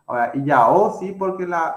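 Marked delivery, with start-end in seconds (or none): 0.3-1.0 "i, ya, o" with the last o stressed; stress on final O. Shown as a demonstration. Teacher explaining where the accent goes, stressed final "o". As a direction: lean louder on the closing o of "i, ya, o", not the a. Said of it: "el acento va, no va en la a, sino en la o".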